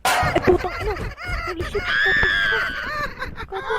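loud high shocked yelling with laughter; startled shouting. Real, not acted. Player gets jump-scared and yells, loud and high.

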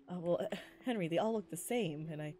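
ordinary talk, nothing marked

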